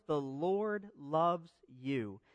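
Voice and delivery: "loves" stretched and set apart; slow right down to weigh "loves"; deliberate, spaced-out reading that dwells on "loves"; stress on loves. Shown as a demonstration. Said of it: "I'll put some emphasis on the word".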